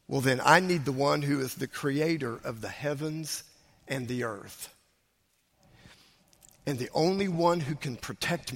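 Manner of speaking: low, soft sermon delivery with long pauses; hushed, solemn preaching. Real, not acted. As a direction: bring it right down and let each phrase settle. Preacher drops to solemn teaching.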